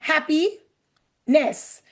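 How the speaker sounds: "Unhappiness" in three slow pieces with long gaps; broken into parts. Shown as a demonstration. Teacher leading class, "Unhappiness" split part by part. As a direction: pause between un, happy and ness. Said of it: "Break it with me".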